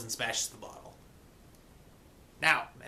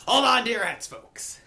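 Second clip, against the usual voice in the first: higher, loud showman's call; hyped-up warning; 9 semitones higher, 7 dB louder than usual. Real, not acted. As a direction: punch it out like a ride announcer. Hyping the entry into dream.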